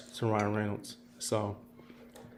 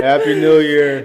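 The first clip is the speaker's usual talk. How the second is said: slow, loud New Year cheer; new year countdown crowd; 3 semitones higher, 9 dB louder, slower than usual. Acted. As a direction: cheer it out slowly.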